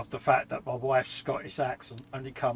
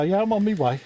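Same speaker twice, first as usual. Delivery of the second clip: meek compliance; 7 semitones higher, 4 dB louder than usual. Retold or imitated. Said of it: "And I go".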